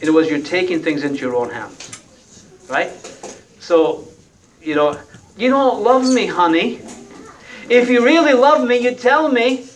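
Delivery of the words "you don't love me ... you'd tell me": high, pleading whine in a put-on wife voice; hammed-up hurt wife begging; mock nagging wife. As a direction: play the wife wheedling her husband.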